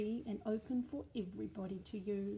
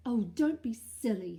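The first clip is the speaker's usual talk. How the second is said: dismissive mum; 11 dB louder than usual. Acted. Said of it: "said Mum".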